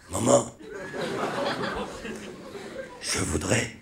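voiceless, croaked impression of a sick father; halting rasp with long gaps, ending unvoiced; throat-cancer voice. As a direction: force each word out through a ruined throat. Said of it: "c'est mon père qui a un cancer de la gorge".

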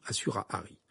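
ordinary delivery, nothing marked

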